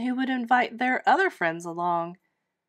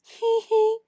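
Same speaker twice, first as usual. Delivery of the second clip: giggling; 13 semitones higher, 5 dB louder than usual. Acted. Girl giggles.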